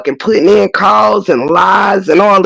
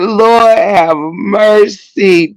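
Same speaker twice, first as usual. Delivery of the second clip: hammed-up wail; 3 semitones higher, much slower than usual. Mocking sentimental players with a wailing fake cry. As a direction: wail it like overcome with tears. Mock sobbing gratitude speech.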